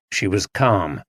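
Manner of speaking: ordinary delivery, nothing marked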